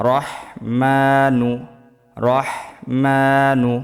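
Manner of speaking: রহমানু stretched very slowly with the long aa held; slow drawn reading. Shown as a demonstration. Teacher modelling রহমানু very slowly for repeating. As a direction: draw out রহমানু. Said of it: "আমার সাথে সাথে পড়বেন".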